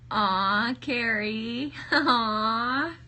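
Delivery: loud, high, touched "aw"; gushing. Real, not acted. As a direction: coo loudly with a laugh. Gushing at a viewer.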